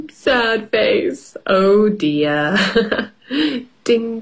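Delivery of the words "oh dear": ordinary delivery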